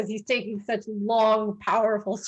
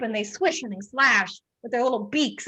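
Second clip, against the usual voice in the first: ordinary delivery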